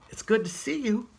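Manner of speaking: high, quick squirrel greeting; a tiny squirrel, glad to see his friend. Acted.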